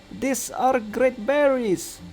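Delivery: high, slow insistence; drawn-out, high-voiced protest; indignant retort. A narrator's reading. Fairy arguing back.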